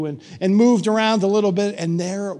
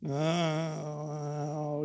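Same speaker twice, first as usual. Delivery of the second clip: a low, softer, grudging oh; grumbled oh; 4 semitones lower, 10 dB quieter than usual. Retold or imitated. The mayor, cornered into helping, grumbling lower and softer. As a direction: let it out as a reluctant grumble. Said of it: "he grumbled".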